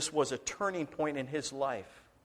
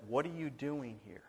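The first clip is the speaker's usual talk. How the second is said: much softer, low and gentle; quiet voice of God; 5 semitones lower, 11 dB quieter than usual. A narrator's reading. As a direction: ask it softly, like a still small voice. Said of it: "a still small voice".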